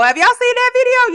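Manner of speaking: high, loud excited burst; excited question. Real, not acted. Host urging viewers to a video, excited.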